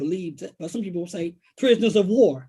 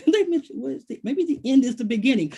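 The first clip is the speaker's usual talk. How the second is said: great-grandmother, mock-mused; 6 semitones higher than usual. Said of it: "she would say".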